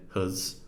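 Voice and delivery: "huz" with a short schwa, said softly; soft unstressed "huz"; reduced weak form. Shown as a demonstration. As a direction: has weakened to "huz". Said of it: "we say huz".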